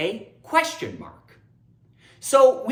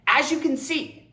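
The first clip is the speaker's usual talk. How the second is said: normal talking voice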